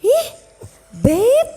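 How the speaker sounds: put-on high, prissy rich-girl voice; snobbish wife. Noble wife sneering at her husband's poor mother. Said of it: "istrinya yang cantik yang bangsawan itu bilang gini".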